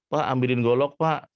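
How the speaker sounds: high, loud household request; mother calling father. Acted. Mother asks father for the machete, high loud call. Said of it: "Kemudian ibu ini bilang".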